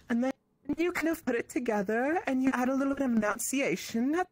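man doing a woman's voice. Shown as a demonstration. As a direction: raise the pitch and enunciate like a girl. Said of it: "my girl voice has gotten better".

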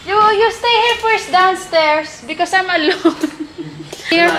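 slow, loud, high call for company; loud pleading call. Real, not acted. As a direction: beg them loudly to stay near. Calling kids, scared alone.

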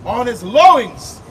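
slow, solemn reading of scripture; measured, solemn scripture voice. Acted. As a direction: read it like a preacher, slow and loud.

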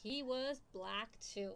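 plain reading voice

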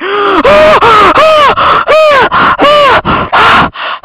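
repeated very high, loud terrified gasps; loud gasps of real fright, one after another; panicked gasping screams. Real, not acted. Jump scare in horror game.

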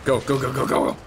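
loud rapid go go go; urgent go go. Real, not acted. Player urging the character to run, loud and urgent. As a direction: urge her on, loud.

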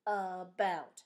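"about" broken slowly into two syllables; split into syllables. Shown as a demonstration. Teacher showing the syllables in about, split into syllables. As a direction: sound out "about" as a-bout, both parts clear. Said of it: "about has two syllables".

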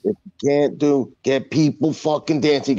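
normal talking voice

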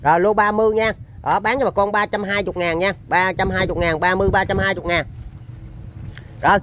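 ordinary talk, nothing marked

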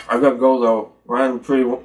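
talking around food in mouth; chewing while speaking; mouth full of pizza. Real, not acted. Seen 1: Bites at -1.0; crust hangs from lips to 1.6, gone by 2.8.

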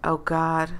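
plain reading voice

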